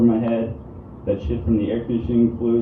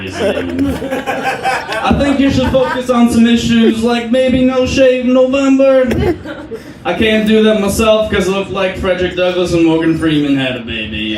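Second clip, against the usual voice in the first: hoge, luide, trage Cosby-stem; Bill Cosby impression; 11 semitones higher, 5 dB louder, slower than usual. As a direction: speel Cosby die Richie vermaant, hoog en traag. Barack O'Cosby impression voice.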